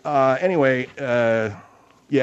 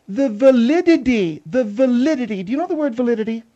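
higher, haughty woman's voice; Margaret; 9 semitones higher than usual. Acted. Margaret arguing architecture with Phil before the audience, haughty. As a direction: lecture him as an indignant, higher-pitched woman. Margaret argues her case.

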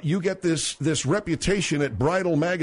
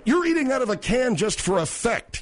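high-pitched, incredulous challenge; indignant accusation; 7 semitones higher than usual. Real, not acted. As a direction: call out his act, voice pitched up. Host accusing caller in argument.

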